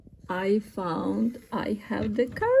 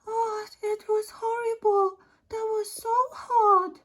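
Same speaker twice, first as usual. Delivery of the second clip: distressed guest; 9 semitones higher than usual. Pretend. Visitor complains to the host about the horrible heat, high-pitched distress. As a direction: play a hot, worn-out visitor, pitched well up. Acted guest arriving hot and upset.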